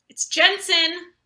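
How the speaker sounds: the boss is here, say it with alarm; alarmed realization. Acted. Seeing Jensen approach, an alarmed slow realization.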